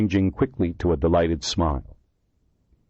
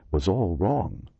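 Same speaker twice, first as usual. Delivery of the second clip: ordinary delivery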